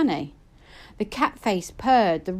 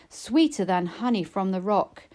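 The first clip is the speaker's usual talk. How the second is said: ordinary delivery, nothing marked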